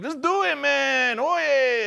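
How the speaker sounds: high, loud mock Mexican accent for the warlord. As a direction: ham up a Mexican bandit boss giving orders. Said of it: "he's like".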